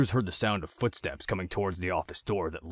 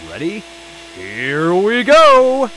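much higher, much louder, long drawn-out whoop; thrilled; 14 semitones higher, 19 dB louder, much slower than usual. A narrator's reading. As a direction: shout it like riding a rollercoaster. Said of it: "like he was on a carnival ride".

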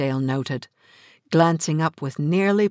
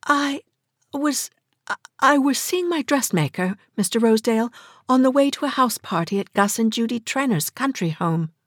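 much higher, stumbling explanation; flustered lady; 10 semitones higher than usual. A narrator's reading. Miss Bart explains herself to Rosedale, flustered. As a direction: trip over your words, embarrassed.

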